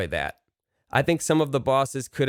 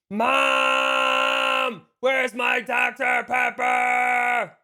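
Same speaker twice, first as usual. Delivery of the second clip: put-on high-pitched kid shouting for mom; whiny kid yelling; 13 semitones higher, much slower than usual. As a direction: yell to Mom like a bratty kid. Comic gag yelling to mom.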